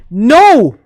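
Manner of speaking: loud, high exclaimed no; emphatic rejection. Real, not acted. Streamer rebutting fans' claim about turn-based RPGs, emphatic shouted rejection. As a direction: shout it down in exasperation.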